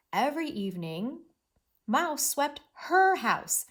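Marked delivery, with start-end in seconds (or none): 2.7-3.7 "her" held long and high; big stress on "her", falling on "house"; emphasis on "her". Shown as a demonstration. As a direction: punch and stretch the word "her". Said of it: "said the word her with emphasis".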